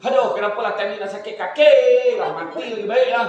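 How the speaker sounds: a loud self-pitying grumble; complaining patient. Acted. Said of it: "Tapi kalau dia kata".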